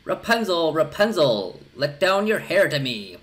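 high, loud call up to the tower. A narrator's reading. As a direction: call out to the window above. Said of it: "she placed herself beneath it and cried".

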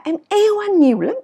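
"yêu" soaring high and loud; stress on YÊU. Shown as a demonstration. Teacher declaring love, not hate, stress on YÊU. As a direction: push "yêu" up high with force. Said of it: "nhấn mạnh vào cái hành động là mình yêu".

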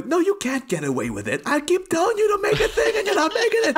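high, laughing mock outburst; mock exasperated tirade. Podcaster plays a fed-up executive scolding filmmakers, mock exasperation. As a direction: build to a high, exasperated rant in fun.

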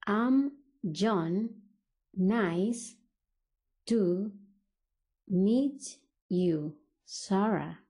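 slow, with long gaps between words. Shown as a demonstration. English teacher models John greeting Sarah, word by word. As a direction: break the phrase up for learners.

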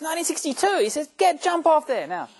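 high, loud burst of mock outrage; outraged yell. Believer turning on a rival Anglican, outraged high yell. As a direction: explode with indignation, voice leaping high.

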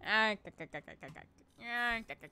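loud "ah" breaking into rapid clicks; stuttering croak. Pretend. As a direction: rattle off a throaty k-k-k. Repeats the imitated rattling noise.

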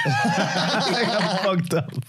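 giggling through the admission, stretched out slowly; laughing confession. Retold or imitated. Telling his friends how he admits messing up the route, laughing. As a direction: laugh it out like a guilty kid.

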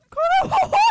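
piercing high scream at full volume; shrieked demand. Pretend. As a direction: scream it at the top of your lungs. Screaming at burning character.

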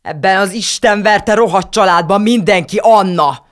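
put-on girl bursting out at the family; sudden shout. A narrator's reading. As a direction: explode into a yell. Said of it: "üvöltött közbe hirtelen".

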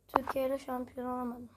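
normal talking voice

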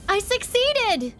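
high, slow, thrilled cry; excited. Real, not acted. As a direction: celebrate the win. Success on dice roll.

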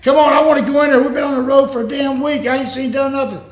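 high, exasperated retold rant; worked-up pleading with a travel buddy; getting mad. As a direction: argue heatedly, as if retelling your own complaint. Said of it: "So I'm getting mad".

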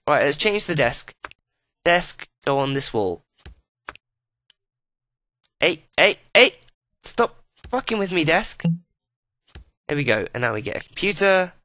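normal talking voice

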